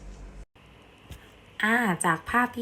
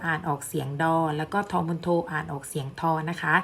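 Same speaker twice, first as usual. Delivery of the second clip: ordinary talk, nothing marked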